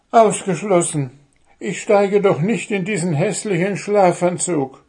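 high, loud indignant gnome; high gnome voice rising in protest. Acted. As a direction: refuse it outright, up high. Gnome refuses the pyjamas.

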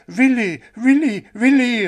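high, loud repeated calls; calling out. A narrator's reading. The fawn cheering the owl on, loud. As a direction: cheer his name again and again. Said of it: "rief Pünktchen".

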